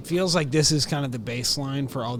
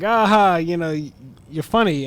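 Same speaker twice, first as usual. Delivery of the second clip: higher, much louder laughing praise; laughing Henry Hill; 5 semitones higher, 9 dB louder than usual. Retold or imitated. Henry Hill praising a friend's story, laughing. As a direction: laugh it out, all easy. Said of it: "Henry Hill's character is like".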